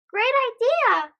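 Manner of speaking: excited cheer. Acted. Everyone agrees.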